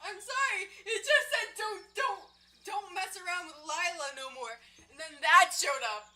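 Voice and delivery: high, shaken and rattled; scared horror game streamer. Real, not acted. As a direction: still trembling from the scare. Shaken after jump scare.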